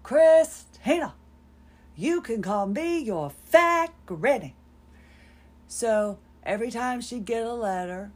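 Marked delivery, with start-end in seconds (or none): 0.0-4.5 imitating grandmother's Sioux Indian accent, much higher, louder, slow; put-on Sioux accent. Grandmother embracing the nickname in a Sioux Indian accent, loud and drawn out. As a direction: sing out the name in a Sioux Indian accent, then settle. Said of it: "she spoke in the most ... Sioux Indian accent".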